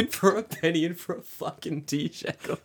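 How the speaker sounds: high, laugh-choked words; wheezy giggling through the line; helpless laughter. Real, not acted. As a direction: try to repeat the joke while cracking up. Cracking up repeating friend's joke.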